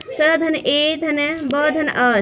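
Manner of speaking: each sound pulled apart slowly, "स धन ए धन ब धन अ"; slow sound-by-sound spelling. Shown as a demonstration. Teacher to students, building सेब sound by sound, slowly. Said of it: "सेब में कौन कौन सी ध्वनियाँ जुड़ी हुई हैं".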